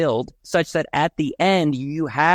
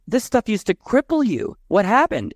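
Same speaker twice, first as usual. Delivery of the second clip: higher-pitched, incredulous questioning; retold amazement; 8 semitones higher than usual. Mother questioning her no longer bothered teenager, retold amazement. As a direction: imitate a baffled mother wanting an explanation.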